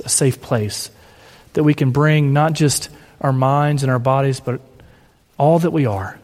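low, unhurried prayer voice. Acted. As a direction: lead the congregation gently in prayer. Pastor leads closing prayer.

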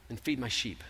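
low, soft instruction; gentle command. Acted. Jesus telling Peter by the fire, low and soft. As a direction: say it quietly, close.

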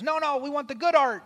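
loud, high-pitched protest from the back; grumbling heckler. Acted. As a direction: shout out an impatient complaint. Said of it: "somebody grumbles in the back and goes".

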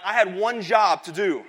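drawn-out mock anguish at himself; mock despair. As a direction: groan it like a husband who failed. Realising he forgot the salt.

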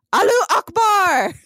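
high-pitched, hammed-up battle cry; acted shout. A narrator's reading. Host reading Taliban fighter's victory cry, high mock shout. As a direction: cry it out high, drawing out the vowels. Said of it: "raises his rifle to the air and shouts".

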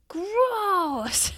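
high, loud disgusted "Gross"; grossed-out kid. Retold or imitated. Daughter hears about sex and squeals grossed out. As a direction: squeal it like a grossed-out little girl. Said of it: "her response was".

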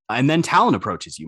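usual speaking voice

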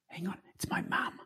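imitating a hushed aside, breath only; whisper. Trainer pretending his mum rang during the demo, whispered. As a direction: whisper it like you're sneaking a phone call mid-show. Trainer fakes taking mum's call.

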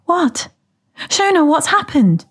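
high, anxious questions; alarmed. A narrator's reading. Moira finds Shona pale on doorstep, alarmed.

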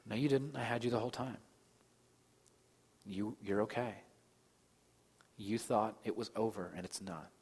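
soft, unhurried comforting voice with long pauses; gentle reassurance. Retold or imitated. Father hugging the one who cried out, gentle reassurance. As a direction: reassure tenderly, as a father calming a child.

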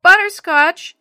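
very loud, high pet call. A narrator's reading. Jenny searching for her cat, calling out. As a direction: call the name down the street. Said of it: "she called".

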